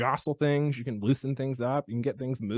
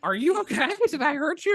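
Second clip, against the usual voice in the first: alarmed concern; 12 semitones higher, 4 dB louder than usual. Retold or imitated. Chiropractor retells checking on screaming patient.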